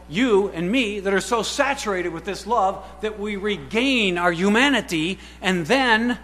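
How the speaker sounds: fervent sermon climax; preaching. Acted. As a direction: press the congregation hard. Preaching at full force.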